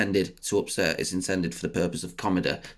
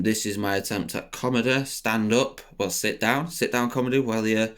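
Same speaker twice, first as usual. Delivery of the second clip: normal talking voice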